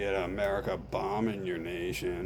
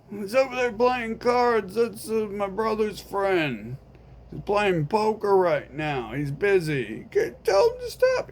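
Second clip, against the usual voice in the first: a higher, offhand friend voice; lightly voiced friend; 11 semitones higher, 5 dB louder than usual. Friend refusing to help, mildly raised voice. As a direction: shrug it off, unbothered. Friend explains, demands finger.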